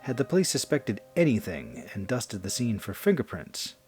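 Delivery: ordinary delivery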